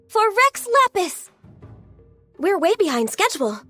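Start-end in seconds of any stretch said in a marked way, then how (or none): 0.1-1.3 high, rallying cry; fervent high-pitched war cry; battle cry. Acted. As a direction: cry out a dedication as you strike. Battle cry invoking her lord.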